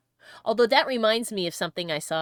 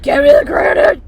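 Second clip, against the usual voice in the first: hammed-up bellow, much louder and higher; dwarf's shout; 7 semitones higher, 17 dB louder than usual. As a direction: yell it like a greedy dwarf demanding stone. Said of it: "He's like".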